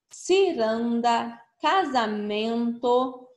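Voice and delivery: each word read out slowly, syllable by syllable; teacher modelling a word list for children, slow and clear; slow model reading. Shown as a demonstration. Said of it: "Então, vamos ler as palavras".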